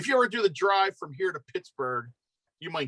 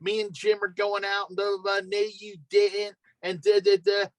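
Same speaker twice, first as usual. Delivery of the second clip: put-on higher-pitched thick Philly accent chatter; Philly accent impression; 5 semitones higher than usual. Guest imitates the online lady, thick Philly accent. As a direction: imitate a Philly lady rambling about errands. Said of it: "worst Philly accent".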